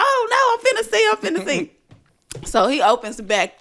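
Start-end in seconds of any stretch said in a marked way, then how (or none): 0.0-1.7 much higher, eager outburst; eager exclaim. Retold or imitated. James eager to look for the ghost, high eager voice. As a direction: jump at the chance, pitched up. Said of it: "he's like".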